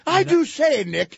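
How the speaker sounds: high, loud mock English accent; posh English tourist. English couple greeting the radio host, mock English accent. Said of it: "horsey face English people".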